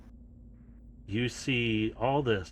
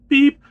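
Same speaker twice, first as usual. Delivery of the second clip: beep sound; 15 dB louder than usual. Acted. Keypad accepts the code.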